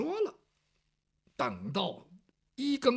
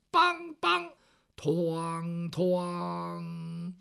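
clapper knocks; 3 semitones lower, much slower than usual. Acted. Watchman strikes the night clapper.

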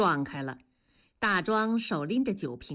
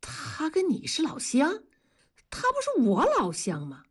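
taken-aback, higher-pitched questions; no longer amused; 7 semitones higher than usual. Acted. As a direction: query it in sudden disbelief. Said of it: "大庄不笑了". (不笑了，诧异地反问)

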